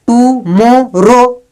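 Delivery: "Tomorrow" slowly split tu-mo-ro; slow, separated "tomorrow"; three syllables. Shown as a demonstration. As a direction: say "tomorrow" one syllable at a time. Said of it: "तीन syllable".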